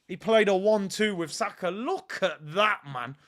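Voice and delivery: ordinary talk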